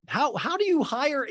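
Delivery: normal talking voice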